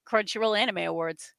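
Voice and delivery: normal talking voice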